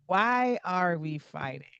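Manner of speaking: ordinary talk, nothing marked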